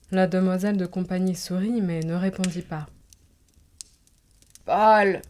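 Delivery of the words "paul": loud call from behind the screen. A narrator's reading. Countess calls her grandson from behind the screen, loud call. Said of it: "cria la comtesse".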